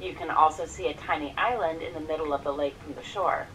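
ordinary talk, nothing marked

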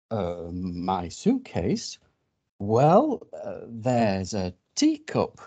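very slow, halting reply; hesitant strange animal. Acted. Strange animal telling bird what's in the suitcase, hesitant. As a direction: answer haltingly, drawing the words out.

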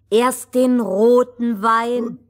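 ordinary delivery, nothing marked

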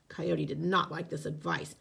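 plain reading voice

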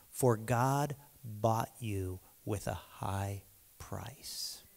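slow, breathless whispered scripture; whispered Bible verse. Acted. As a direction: hush it like a sacred secret. Reading scripture hushed.

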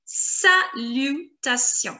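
salutation drawn out, each syllable; slow model word. Shown as a demonstration. Teacher modelling salutation slowly. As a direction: salutation spoken slowly, final n nasal. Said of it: "the word salutation".

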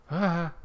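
short, loud, high startled cry; startled yelp. Real, not acted. Surprised by a mob in the cave, startled yelp. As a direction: jump at a sudden scare. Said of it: "That actually did kind of scare me".

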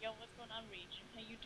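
faint, far below normal level; tiny, barely-there greeting; very quiet. Real, not acted. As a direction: say it barely audibly. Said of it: "You sound really quiet".

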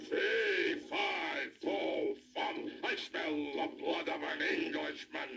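slow storybook giant chant; lumbering cartoon giant threat; menacing giant. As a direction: chant it heavily, word by word, as a giant. Giant's chant coming down beanstalk.

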